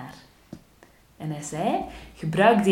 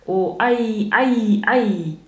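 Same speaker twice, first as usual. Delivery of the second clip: louder, higher howls of pain; wailing; 4 semitones higher, 7 dB louder, much slower than usual. A narrator's reading. Trapped wolf wailing to win the girl's help. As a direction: yelp theatrically, sliding down at the end. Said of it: "nog meer te schreeuwen en te jammeren".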